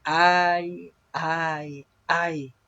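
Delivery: drawn-out cries of woe, read aloud; proclaimed woe. A narrator's reading. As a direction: intone each 'ay' long and separate, the first loudest. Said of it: "diciendo en alta voz".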